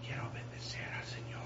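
hushed, unvoiced whisper of a timid worshipper; mock whisper. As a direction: whisper the praise as if barely praying aloud. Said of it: "no estaba ahí hablando bien bajo". (susurro bajito, imitando a un creyente tímido)

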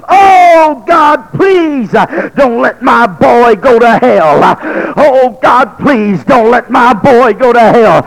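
mother screaming face down behind the bed; screaming plea. Retold or imitated. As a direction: scream it to the top of your voice. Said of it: "a lady screaming to the top of her voice".

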